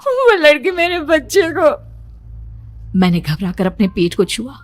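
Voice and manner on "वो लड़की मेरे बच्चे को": higher, halting frightened plea; dazed panic. Acted. Shaken daughter telling mother of the dream, trembling panic. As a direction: stammer out the nightmare. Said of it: "मैं अभी तक अपने पूरे होश में नहीं थी".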